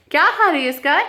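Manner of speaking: high, loud questioning call; calling out sharply. Real, not acted. Vlogger breaks off her story to check on Sky, calling out.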